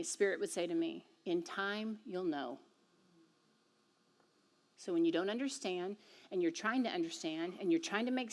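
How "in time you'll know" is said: God's voice. Retold or imitated. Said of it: "that's what God would say to me".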